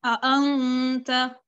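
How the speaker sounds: अ, अंत very slow, hamza clearly stopped; slow tajweed, अ and अंत separated; clearly pronounced. Shown as a demonstration. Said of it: "वाज़ह करके पढ़ा".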